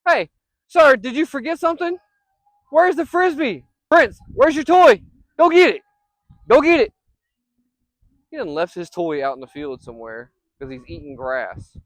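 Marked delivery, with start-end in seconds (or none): 0.1-6.9 high, loud playful commands; calling the dog. Real, not acted. Owner sending his dog back for the frisbee, loud playful call. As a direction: chide him to go find it.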